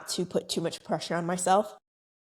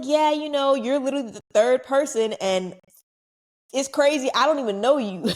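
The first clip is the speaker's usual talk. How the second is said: excited disbelief; 6 semitones higher, 4 dB louder than usual. Retold or imitated. Excitedly telling the fan.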